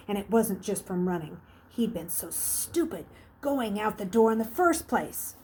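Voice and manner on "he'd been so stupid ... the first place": ordinary delivery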